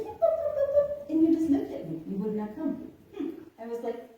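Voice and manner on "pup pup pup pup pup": very high sing-song pup calls; calling a puppy. Retold or imitated. Calling the stray puppy to come, very high and coaxing. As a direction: coax the pup over in a high call. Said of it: "I said".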